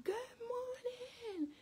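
high, drawn-out singsong good morning; cheery loud greeting. Retold or imitated. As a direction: call it out long and high, way too chipper. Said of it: "and so I greet them".

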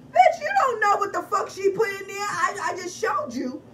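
loud, high indignant scolding; indignant outburst. Real, not acted. Vlogger scolding viewers about other sellers, loud and indignant. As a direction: scold them loudly with high pitch.

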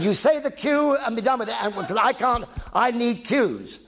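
begging Pat on set for his cue; mock exasperation. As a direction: plead for the cue. Telling Pat to give cue.

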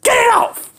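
high, loud pretend cry; mock panic. Friend panics at the thing on his head, mock yelling. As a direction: yelp to get it off.

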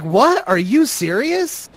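high, incredulous imitation; offended mock disbelief; mildly upset. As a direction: act out her disbelief, pitch jumping up. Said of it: "getting upset about it".

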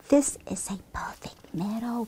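soft, whispery hush; said softly. Acted. Henrietta savouring the meadow, speaking softly. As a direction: serene near-whisper. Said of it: "Henrietta said softly".

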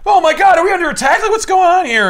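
high, loud frantic alarm; mock panic. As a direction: squeal like you're under attack. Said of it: "And Sam's like".

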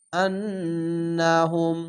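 আন্নাহুম with the doubled নন hummed through the nose; nasal ghunna. Shown as a demonstration. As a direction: hold the ন্ন of আন্নাহুম as a long nasal hum. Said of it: "এখানে ওয়াজিব গুন্না". (আন্নাহুম গুন্নাসহ নাকে টেনে)

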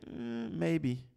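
wishy-washy mock answer; watered-down answer. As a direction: dodge it with a wavering "mm". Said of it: "in our watered down language, we say".

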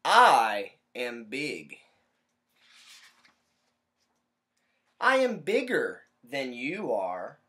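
loud, slow bragging bug voice; big slow boast for the kids; boastful bug. Acted. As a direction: boom it out slowly like a proud little bug. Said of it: "He says".

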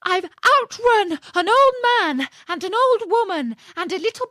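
very high, loud scream. Acted. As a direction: scream it right into his ear. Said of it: "screamed out".

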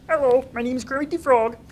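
high, bad cartoon frog impression; high-pitched frog puppet voice; Kermit the Frog. Shown as a demonstration. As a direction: do a poor imitation of a puppet frog. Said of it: "it started off as Kermit the Frog".

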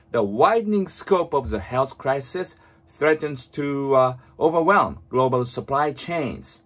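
very slow, fully articulated English reading; read-aloud model. Shown as a demonstration. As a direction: move the lips fully, reading slowly for shadowing. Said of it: "本当に大きな声で唇を思いっきり動かして". (口を大きく動かしてゆっくり音読)

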